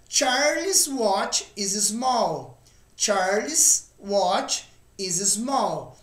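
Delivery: "Charles'" said slowly with one S sound; single S sound. Shown as a demonstration. Teacher shows "Charles'" keeps one S sound. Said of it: "a pronúncia fica a mesma, um único som de S".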